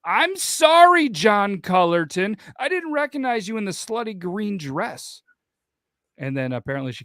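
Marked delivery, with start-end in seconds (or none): 0.1-5.2 high, drawn-out sarcastic yell; mock yell. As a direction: yell it back with mock sorrow. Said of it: "she yelled back at her".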